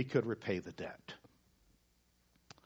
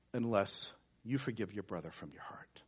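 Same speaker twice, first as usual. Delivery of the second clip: softly whispered warning; solemn whisper; 5 semitones lower, 10 dB quieter than usual. Real, not acted. Preacher to congregation, a solemn whispered warning. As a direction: breathe it as a grave final warning.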